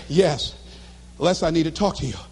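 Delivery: low, sober voice on the phone; subdued girlfriend. Retold or imitated. Girlfriend needs to talk to him, subdued. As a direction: imitate a partner with bad news, pitched low.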